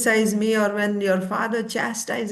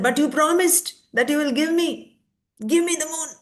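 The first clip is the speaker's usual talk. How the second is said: put-on a higher, insistent little boy's voice; pleading child; 7 semitones higher than usual. Little Nimai reminding his mother she promised him the moon. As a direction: whine it up like a child holding a promise.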